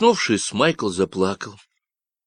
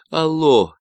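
loud, high call into the radio; shout; 7 semitones higher, 5 dB louder than usual. Acted. Michael shouting into the walkie-talkie, loud. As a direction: shout to get an answer. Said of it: "крикнул Майкл".

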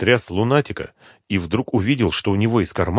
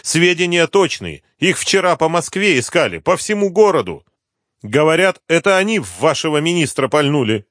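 higher-pitched voice of a pleading snitch; anxious addict informant; 9 semitones higher, 5 dB louder than usual. Acted. Addict tells the inspector about hidden bodies, high insistent voice. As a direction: play the informant eager to be believed.

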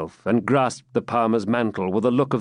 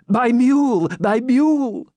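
high, loud, desperate cry; shrill panic of a frightened old man; 13 semitones higher, 5 dB louder than usual. A narrator's reading.

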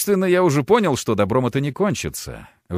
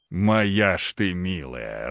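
delighted; 4 semitones lower, much slower than usual. A narrator's reading. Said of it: "расцвёл Аристарх".

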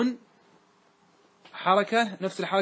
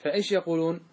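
usual speaking voice

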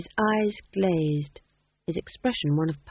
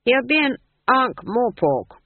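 put-on stern old witch; 7 semitones higher, 6 dB louder, slower than usual. Granny voiced.

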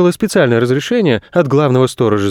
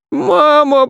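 very high wail for mum; boy bawling; 3 dB louder than usual. A narrator's reading. Boy lost in empty space, bawling. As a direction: bawl it out in a squealing child voice. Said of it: "заорал на всю пустоту".

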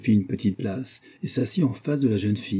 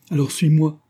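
a higher, louder urgent plea; impetuous, higher cry to come with him; 7 semitones higher, 5 dB louder than usual. A narrator's reading. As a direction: sweep her away with it. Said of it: "avec impétuosité".

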